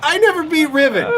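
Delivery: high-pitched mock wail of admission; hammed-up self-shaming outburst; mock confession. Player owning up to being a big loser, mock confession.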